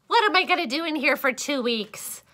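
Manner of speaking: ordinary delivery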